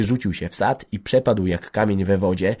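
usual speaking voice

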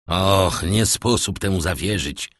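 usual speaking voice

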